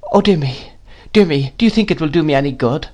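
put-on high, plaintive young mother; helpless cry. A narrator's reading. Mother wonders about tea, helpless. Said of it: "cried my mother in a helpless manner".

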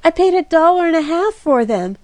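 high, loud wail over ruined gloves; mournful, hammed-up complaint; groaning lament. A narrator's reading. Kitty grieves to Jack over her gloves, high and groaning. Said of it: "groaned Kitty".

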